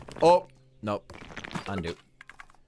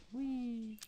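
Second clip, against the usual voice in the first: squeaky whee; 14 semitones higher, 10 dB quieter than usual. Pretend. Voices a little scene.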